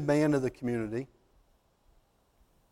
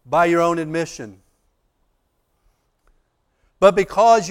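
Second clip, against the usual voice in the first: louder, higher preaching build; preaching at full force; 6 semitones higher, 7 dB louder than usual. Acted. Preacher retelling the judge's mercy to the congregation, rising preaching. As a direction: lift the congregation toward mercy.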